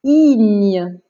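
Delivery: loud, drawn-out syllable; careful dictation. Shown as a demonstration. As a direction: dictate it firmly for careful listening. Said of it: "attention, écoute bien".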